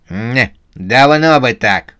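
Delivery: lightly grumpy retort; grumbling. A narrator's reading. Susanna answers the old man who gave in, grumbling. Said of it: "проворчала Сюзанна".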